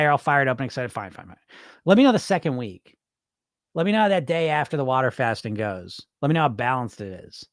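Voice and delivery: normal talking voice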